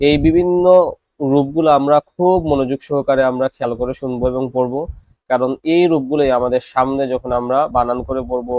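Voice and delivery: ordinary talk, nothing marked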